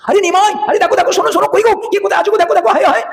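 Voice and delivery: high, frantic cry of alarm; a terrified mother calling out for help; a mother wailing in panic at the sight of the snake; panicked mother's cry. Acted. Mother Sachi finds Nimai on snake.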